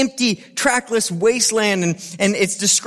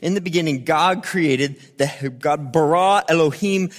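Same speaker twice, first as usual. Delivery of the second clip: solemn scripture reading; 6 semitones lower than usual. Acted. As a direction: read it low and reverent, like a poem. Reads Genesis one as poem.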